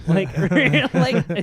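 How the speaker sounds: loud, high laughing between words; cracking up mid-story; laughing hard. Real, not acted. Acting out the waitress, loud and high with laughter. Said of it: "awkwardly laughs".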